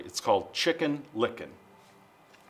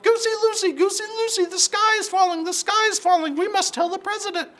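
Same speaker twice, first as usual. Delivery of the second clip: panicked clucking hen. Acted.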